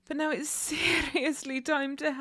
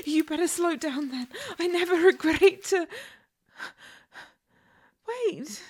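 ordinary talk, nothing marked

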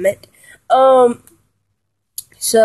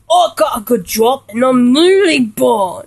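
mock gruff English accent, stretched vowels; gruff English accent imitation; 2 semitones higher than usual. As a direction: imitate a drunk English bellhop, badly. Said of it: "I get the urge to do that imitation".

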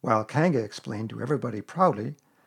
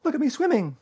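very high squeak; Roo; 12 semitones higher than usual. A narrator's reading. Little one showing off in the pool, squeaking.

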